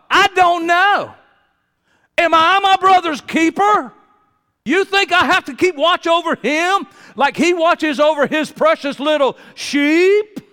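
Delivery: sneering, defiant Cain, high and loud; cold disrespect. Acted. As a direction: spit it back at God with contempt. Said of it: "with the coldest disrespect".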